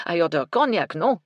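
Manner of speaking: put-on cognac said as kɔnjæk in a French accent, sharp. A narrator's reading. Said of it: "a strong French accent".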